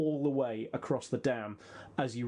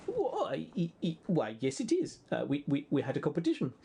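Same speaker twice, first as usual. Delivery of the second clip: put-on Lapland accent. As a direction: proudly stutter in a mock Lapland voice. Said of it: "dead-on Lapland accent".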